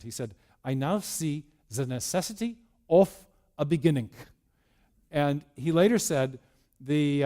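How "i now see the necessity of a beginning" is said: put-on thick German-accented English, deliberately spoken; heavy German accent. Einstein announcing to the media what he saw, heavy German accent. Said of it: "in his heavy German accent".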